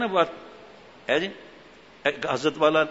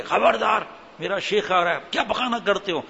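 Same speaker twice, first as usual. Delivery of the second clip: bullying shout; 6 semitones higher than usual. Retold or imitated. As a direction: bark out a warning, clearing the way. Strongman yelling at children.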